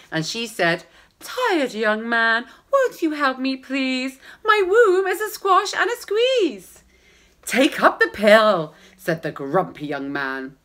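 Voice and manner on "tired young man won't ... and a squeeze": pleading woman. Acted.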